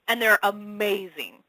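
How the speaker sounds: normal talking voice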